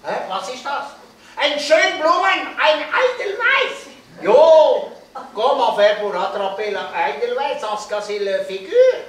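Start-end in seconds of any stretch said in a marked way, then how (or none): 0.0-4.9 put-on much higher, slow and wondering German accent; amazed Swiss German. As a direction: marvel at the flower in a high Swiss German voice. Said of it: "il dit".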